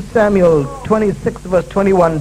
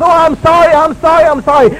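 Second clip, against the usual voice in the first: mock glib apology; 7 semitones higher, 6 dB louder than usual. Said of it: "Not just".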